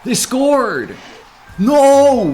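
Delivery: high, loud cry of dismay; real gamer despair; dismayed shout. Real, not acted. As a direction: yell at the conceded goal. Opponent equalizes.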